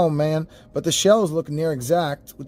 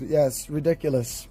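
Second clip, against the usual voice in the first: ordinary talk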